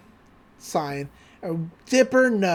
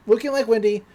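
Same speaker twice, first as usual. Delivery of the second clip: ordinary talk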